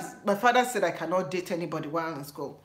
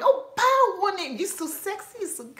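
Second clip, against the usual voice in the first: high, fading swoon; imitating flirting classmate; 10 semitones higher than usual. Classmate repeating "báwo ni", high then fading. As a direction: swoon over the word. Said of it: "I said".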